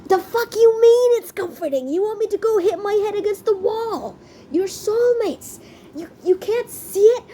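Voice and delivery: high-pitched exasperated outburst; crashing out. Retold or imitated. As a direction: wail it in mock outrage at the oblivious soulmates. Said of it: "I was crushing out".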